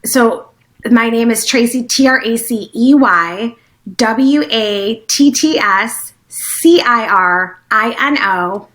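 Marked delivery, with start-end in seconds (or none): 1.8-8.7 each letter slow and separate, letter by letter; spelling out slowly. Shown as a demonstration. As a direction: spell the name slowly and clearly for the listeners. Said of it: "have you spell your name".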